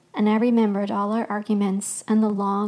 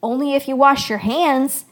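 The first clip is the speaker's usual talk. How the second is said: loud, higher-pitched cheeky interjection; emphatic, pitched-up condition; interrupting; 4 semitones higher, 6 dB louder than usual. A narrator's reading. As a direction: cut in with a raised voice. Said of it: "Jeb interrupted".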